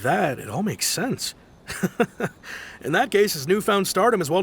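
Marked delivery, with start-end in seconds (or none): 1.7-2.8 short breathy chuckle; light laugh. Acted. Sailor laughing after hearing of Kazuha's feat. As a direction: laugh in pleased surprise.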